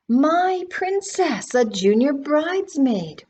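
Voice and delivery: high, slow doting coo; grandma cooing. A narrator's reading. Grandma cooing over her granddaughter, high and doting. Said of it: "Grandma Talbot cooed".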